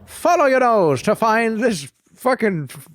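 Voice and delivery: high, slow, drawn-out cartoon bird voice; sing-song cereal mascot, stretched and high; hammed-up cereal ad mascot; Toucan Sam. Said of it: "Yeah, Toucan Sam".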